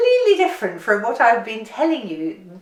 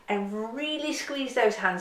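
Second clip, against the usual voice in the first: normal talking voice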